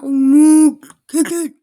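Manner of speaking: sudden loud yell at the game; frustrated shout. Real, not acted. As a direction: shout it out in real exasperation. Yelling at the game.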